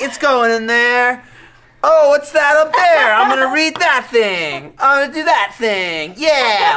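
put-on high, slow, loud drunken wonder. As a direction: play yourself wasted and distracted. Acting out own drunk self peeing.

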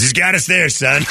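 lightly voiced dad conceding with a chuckle in it; a hint of a tickled father giving in; amused dad. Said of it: "He goes".

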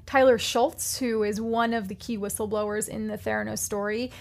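normal talking voice

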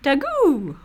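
Daggoo said high, Kiwi style, like Rhys Darby; New Zealand accent. Retold or imitated. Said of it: "that's the way Rhys Darby said it".

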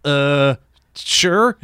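hesitant, climbing to a high "sure"; stall, then squeak out agreement; mock-flustered yes, rising in pitch; retold panic. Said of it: "I panicked".

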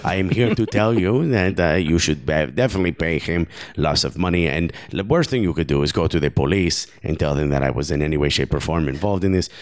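low mock French-Argentinian accent; comic low-pitched accent, vaguely French; Karim Benzema. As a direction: explain it in a put-on, muddled foreign accent. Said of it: "I promise this is Karim Benzema".

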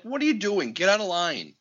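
mock-indignant at a line cutter; exasperated scolding. Scolds line cutters in retold rant.

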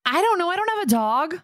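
high-pitched defensive plea; playful protest. Real, not acted. Playfully defends herself against being corrected.

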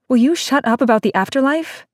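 put-on fed-up girl snaps at the boy; angry demand. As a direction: cut him off in exasperation. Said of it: "demanded Seiya".

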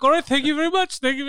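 high, chirpy mock politeness; agent signing off. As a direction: imitate an agent hurrying off the phone. Acts agent hastily ending the call.

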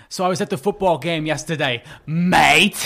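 plain setup capped with a punched mock-British tag; bad British accent. Shown as a demonstration. Demonstrating the tip on football, bad British accent. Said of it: "wants to do a British accent".

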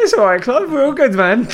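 high, loud, breaking into laughter; laughing reassurance. Retold or imitated. Barman letting off the regular whose arm he broke, loud and laughing. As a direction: blurt it out brightly through a laugh.

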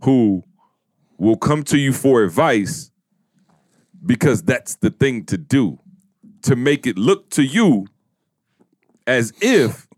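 measured words with long pauses; slow, deliberate. Real, not acted. Host thinking of some people in particular, slow and deliberate. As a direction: spell it out slowly, phrase by phrase. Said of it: "I'm gonna try to say it slow".

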